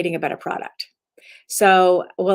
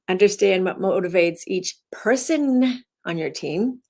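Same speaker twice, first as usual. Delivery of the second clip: "person" lifted with a rising tune; single out "person" as the point; "person" stressed, the rest light. Shown as a demonstration. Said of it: "you emphasize it to change the meaning".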